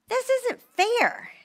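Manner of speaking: high slow sulky this isn't fair; whiny complaint. Retold or imitated. Wife sulking to herself, high and slow. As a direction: whine it like a child. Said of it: "I was just like".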